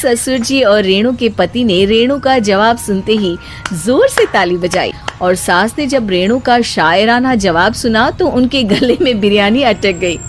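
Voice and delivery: ordinary delivery, nothing marked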